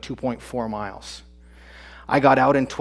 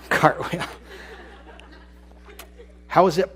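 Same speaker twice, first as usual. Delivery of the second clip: laughing disbelief; 6 semitones higher than usual. Real, not acted. Laughing at daughter's idea.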